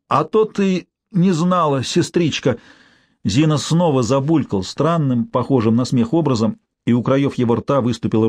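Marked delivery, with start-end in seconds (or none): 0.1-2.6 ядовитая насмешка. Acted. Умирающий язвит сестре.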